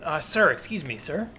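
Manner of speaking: usual speaking voice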